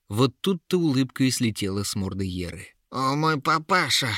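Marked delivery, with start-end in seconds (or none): 2.9-4.1 high, alarmed creature cry; Yera yelling. A narrator's reading. Troll-woman realises it is a trap, high alarmed yell. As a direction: cry out in dismay, drawing it out. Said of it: "заорала она".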